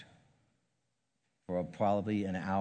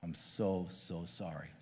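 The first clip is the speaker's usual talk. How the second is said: soft, hushed, slow apology; a murmured, broken I'm so sorry; retold crying; 3 semitones lower, 8 dB quieter, slower than usual. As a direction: voice sinking to almost nothing in grief. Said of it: "and he was crying".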